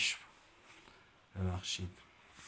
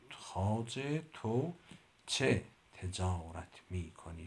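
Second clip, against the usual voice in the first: slow careful; slower than usual. Shown as a demonstration. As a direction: say it slowly so every sound comes out clean. (آرام و شمرده، کلمه به کلمه)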